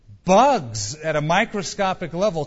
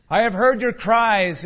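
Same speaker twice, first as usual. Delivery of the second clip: loud, higher proclamation; announcing voice; 6 semitones higher, 6 dB louder than usual. A narrator's reading. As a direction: announce it to a whole cave. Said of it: "it announced".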